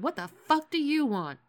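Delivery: loud, high annoyed snap; angry snap. Retold or imitated. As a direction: bark it at the door. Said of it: "yanked open the door and said".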